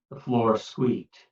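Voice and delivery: ordinary delivery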